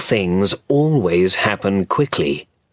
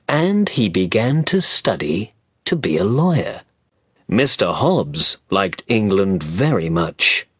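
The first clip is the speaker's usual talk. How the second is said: ordinary delivery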